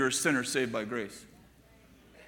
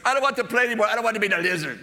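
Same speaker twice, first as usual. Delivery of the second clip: higher, louder mock child's wail; whining child, retold; 5 semitones higher, 4 dB louder, much faster than usual. Grandson begging grandpa to end the game, imitated whine. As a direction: protest like a fed-up little kid.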